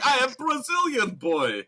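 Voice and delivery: put-on hammy Russian accent, laughing; mock Russian accent. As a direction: play the bad spy, giggling. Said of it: "a Russian accent".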